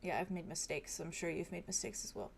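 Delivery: quiet. Acted. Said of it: "He just says".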